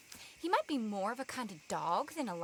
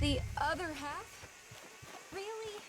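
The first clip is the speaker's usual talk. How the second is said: dismayed surprise; 6 semitones higher, 4 dB quieter, much slower than usual. Acted. Aghast at the lion being eaten.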